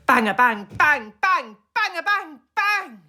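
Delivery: loud high rising bangs; knock-knock sound effect; mock door banging. A narrator's reading. Banging on doors.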